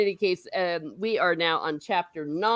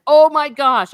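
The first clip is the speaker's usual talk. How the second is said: excited gasp; 8 semitones higher, 11 dB louder than usual. Real, not acted. Excited at a chat comment.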